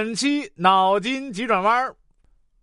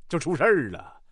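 ordinary delivery

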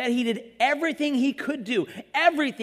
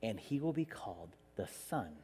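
much softer, lower, half-whispered angel; hushed angel; 12 semitones lower, 12 dB quieter than usual. Retold or imitated. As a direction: hush it like a sacred secret. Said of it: "the angel's like".

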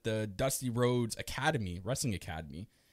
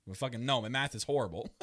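ordinary talk

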